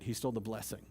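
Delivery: ordinary talk, nothing marked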